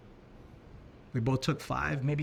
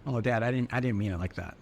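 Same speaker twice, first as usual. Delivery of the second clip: lightly voiced daughter; 5 semitones lower than usual. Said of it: "almost like her saying".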